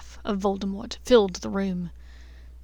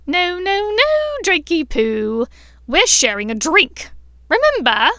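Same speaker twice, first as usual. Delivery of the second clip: loud sing-song taunt; 8 semitones higher, 9 dB louder than usual. Acted. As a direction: taunt the boy with mock sweetness. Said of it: "Bellatrix's sing-song voice rang out".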